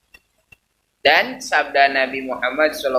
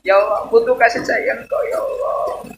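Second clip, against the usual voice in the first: heartfelt plea; 8 semitones higher than usual. Shown as a demonstration. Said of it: "enggak itu".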